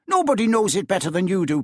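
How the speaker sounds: fast, heated jab; wife pressing her husband about Scrooge; indignant wife. A narrator's reading. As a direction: rush it out in anger. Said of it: "said she".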